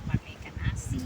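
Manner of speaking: barely voiced, breathy murmur; almost whispered. Real, not acted. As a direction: say it under your breath. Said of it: "Yang keras ngomongnya".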